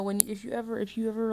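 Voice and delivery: ordinary delivery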